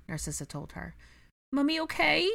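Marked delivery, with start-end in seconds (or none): 1.5-2.3 put-on high, loud toddler voice asking worriedly; a little boy checking on his mummy; small child. A narrator's reading. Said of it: "Aurelian asked".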